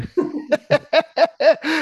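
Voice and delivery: high burst of real laughter; hearty laugh. Real, not acted. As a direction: laugh out loud, high and free. Laughs about books putting him to sleep.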